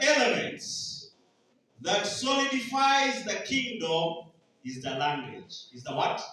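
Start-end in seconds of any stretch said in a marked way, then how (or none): none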